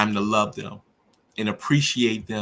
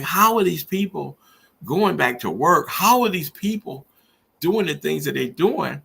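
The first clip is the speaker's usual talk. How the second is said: much higher and louder, exasperated disbelief; angry outburst; 7 semitones higher, 4 dB louder than usual. Retold or imitated. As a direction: relive the outrage of a grieving father. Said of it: "I was angry".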